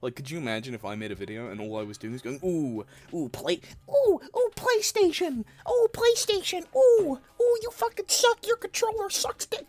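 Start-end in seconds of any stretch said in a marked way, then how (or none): 2.4-9.7 put-on shrill much higher, loud squealing kid taunts; annoying Fortnite kid voice. Imagining a video of a Fortnite kid screaming at PlayStation. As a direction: squeal like a bratty young gamer. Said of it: "the annoying little boy voice, the Fortnite gamer voice".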